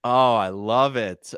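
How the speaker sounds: normal talking voice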